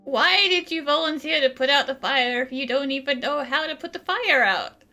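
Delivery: usual speaking voice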